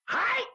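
loud, very high battle cry; one explosive shout as you strike; attack yell. Acted.

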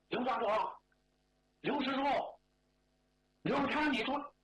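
calling out names, pitched high; 试着喊人. Acted. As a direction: try out a call to the man below. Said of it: "我就这么喊". (高声试着喊人)

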